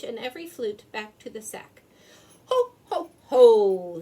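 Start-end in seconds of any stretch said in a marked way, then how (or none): 2.5-4.0 big loud booming ho ho ho; jolly Santa ho ho ho. A narrator's reading. Santa cheers the dog, loud ho ho ho. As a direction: laugh like Santa.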